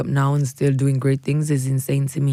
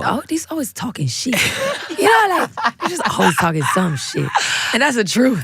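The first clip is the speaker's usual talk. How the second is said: higher and laughing through the words; 8 semitones higher than usual. Real, not acted. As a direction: giggle while spilling the truth. Laughing about gossiping friends.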